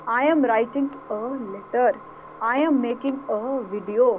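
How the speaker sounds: slow, even dictation; slow example read. Shown as a demonstration. Teacher reading example sentences slowly aloud. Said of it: "व की sound आ रही है".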